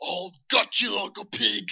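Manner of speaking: slow, drawn-out cockney menace; hammed-up cockney orc threat; slow cockney orc promising violence. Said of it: "they all have that cockney ass, like, accent".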